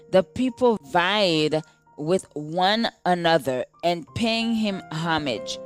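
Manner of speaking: ordinary delivery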